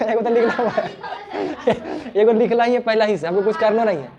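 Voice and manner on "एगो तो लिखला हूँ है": talking through a laugh; real amusement breaking into the words. Real, not acted. Teacher laughing with the class. (हँसते हुए बोलना)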